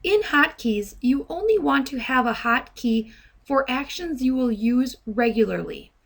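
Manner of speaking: normal talking voice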